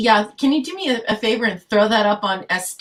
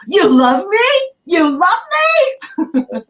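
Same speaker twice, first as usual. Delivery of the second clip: high, loud, drawn-out mock disbelief; teasing Kara; 9 semitones higher, 4 dB louder, much slower than usual. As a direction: taunt him childishly, stretching it out high and loud. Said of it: "by teasing him about his".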